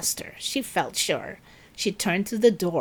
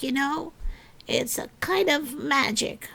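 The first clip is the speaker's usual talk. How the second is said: ordinary delivery, nothing marked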